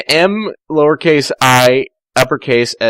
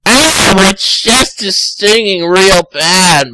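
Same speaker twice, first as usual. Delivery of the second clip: pained Tails; 7 semitones higher, 4 dB louder, slower than usual. Acted. Tails in pain, voiced.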